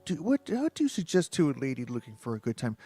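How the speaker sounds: a hint of a sultry seductress; light flirty lady voice; sultry woman.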